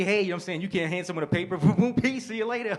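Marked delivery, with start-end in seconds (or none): 1.6-2.8 high and loud, strutting; hyped-up mock celebration. Comic acts out a writer spiking his paper, loud and high. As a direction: celebrate handing over a paper.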